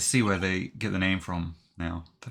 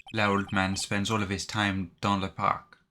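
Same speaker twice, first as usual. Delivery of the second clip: slow, hammed-up bad French accent. Restaurant owner pointing them to the park in a bad French accent. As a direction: roll out dans le parc like a cartoon Frenchman. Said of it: "a very bad French accent".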